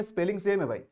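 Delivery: normal talking voice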